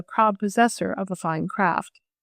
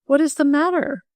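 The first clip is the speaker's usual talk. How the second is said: higher, mildly urgent question; crying out; 6 semitones higher than usual. A narrator's reading. Boy asks the crying girl what is wrong, crying out. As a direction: cry it out in concern. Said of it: "Will cried".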